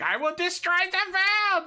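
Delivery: very high, hammed-up mock German villain cry; fake evil Nazi accent. Game master hamming up a world-destroying villain, fake evil Nazi accent. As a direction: shriek it like a cartoon German villain. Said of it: "do my fake evil Nazi accent".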